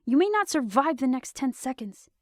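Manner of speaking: high, loud and dangerous; commander's loud, menacing warning; furious threat. Acted. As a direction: threaten him to move aside.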